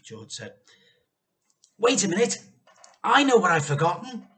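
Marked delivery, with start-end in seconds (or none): 1.8-4.3 sudden realisation. A narrator's reading. George remembering something.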